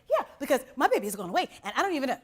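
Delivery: excited retelling. Retold or imitated. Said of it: "she's like".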